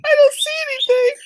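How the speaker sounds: laughing through it. Retold or imitated. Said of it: "she looks at me".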